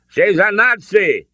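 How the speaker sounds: high, loud, drawn-out accusation; urgent countering. A narrator's reading. Father exposes the woman to his son, urgent shout. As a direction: shout it over her pleas. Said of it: "Henry countered".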